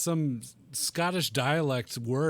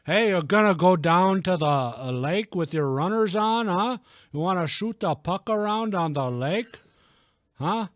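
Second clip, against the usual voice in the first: broad, higher-pitched mock Canadian; cheery exaggerated Canadian accent; 4 semitones higher, 5 dB louder than usual. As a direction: play up a folksy Canadian lilt. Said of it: "I like to do Canadian".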